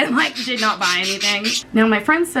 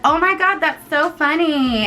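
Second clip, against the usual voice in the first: much higher, gushing imitation of a shop lady; mocking shop lady; 9 semitones higher than usual. Friend mimics a shop lady laughing at their dresses, high gushing parody. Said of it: "this lady's like".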